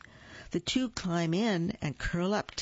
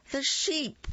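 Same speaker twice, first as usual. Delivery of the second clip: high reveal; 10 semitones higher than usual. A narrator's reading. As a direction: jump up high on the sheep. Dramatic reveal of next animal.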